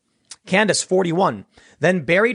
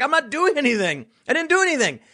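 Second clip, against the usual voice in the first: pleading innocence; 8 semitones higher than usual. Retold or imitated. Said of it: "He's like".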